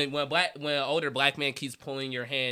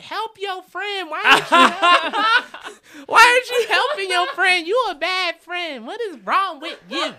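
mock heckling crowd; 13 semitones higher, 6 dB louder than usual. Crowd scolding his friend, high hammed-up mock yell. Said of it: "people were yelling at him too".

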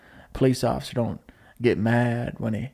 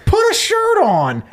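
mock yell; 5 dB louder, slower than usual. Guy yells at shirtless McConaughey.

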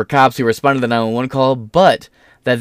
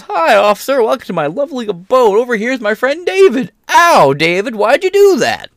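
much higher, sugary welcoming voice; mock cheerful host; 10 semitones higher, 3 dB louder than usual. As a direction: play the oblivious homeowner greeting the cops in a sweet high voice. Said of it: "he opens the door and goes".

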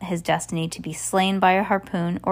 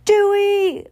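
anguished cry; 14 semitones higher, 6 dB louder than usual. Acted. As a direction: scream the name high and loud in panic. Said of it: "Violet cried".

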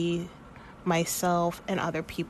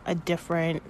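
normal talking voice

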